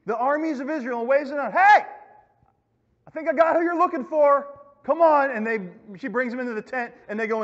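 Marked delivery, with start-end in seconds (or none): 1.5-5.3 loud, high hail to passers-by; calling out. Acted. Woman waving down the army, calling out. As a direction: call them over from a way off.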